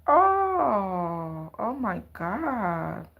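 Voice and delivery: ordinary talk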